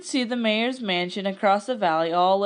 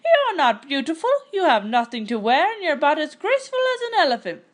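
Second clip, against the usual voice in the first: mocking; 7 semitones higher, 3 dB louder, faster than usual. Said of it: "the girls hooted".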